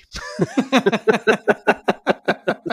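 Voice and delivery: long, high, loud burst of laughter; laughing hard. Real, not acted. Co-host cracks up at the Jimmy Krankie comparison, laughing hard. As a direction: crack up in real, helpless laughter.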